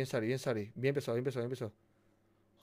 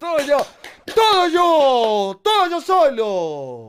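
loud, long-drawn boasting yell; triumphant shout; 4 semitones higher, 7 dB louder, much slower than usual. Real, not acted. Player claiming all the credit from partner, triumphant shout. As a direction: bellow it slowly, stretching each word. Boasting loudly after a success.

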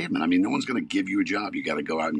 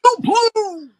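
fan cheer; 9 dB louder than usual. Real, not acted. Michigan fan cheering team.

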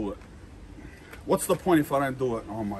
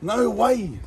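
usual speaking voice

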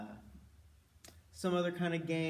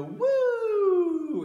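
high, loud whoop as the scarf flies; gleeful whoop; excited woo; 10 dB louder than usual. Shown as a demonstration. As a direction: cheer it up high like a tossed scarf. Librarian demos scarf throw cheer.

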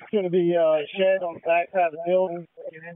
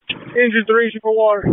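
raised, higher call over the noise; loud radio order; 5 semitones higher, 8 dB louder than usual. Real, not acted. Commander to engines, shouting the water assignment.

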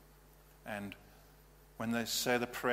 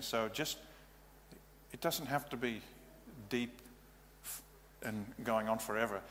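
ordinary delivery

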